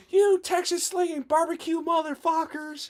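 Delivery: high-pitched mock scream, held in volume; retold screaming. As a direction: imitate screaming at rival fans without full volume. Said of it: "like, screaming".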